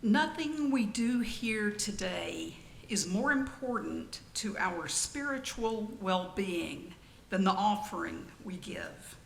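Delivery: ordinary talk, nothing marked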